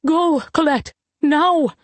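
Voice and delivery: high, pressing motherly cry; urgent cry. A narrator's reading. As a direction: cry out an order, pitched high. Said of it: "her mother cried again".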